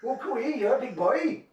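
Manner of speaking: high-pitched Welsh coo-ee; Welsh accent. Retold or imitated. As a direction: mimic a flirty Welsh woman in a high, sing-song voice. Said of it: "I'll say it in accent".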